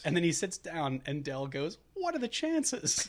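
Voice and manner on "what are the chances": high, slow, sing-song "What are the chances?"; a goofy, delighted mock Del; hammed-up cheery seatmate; mock cheerful Del.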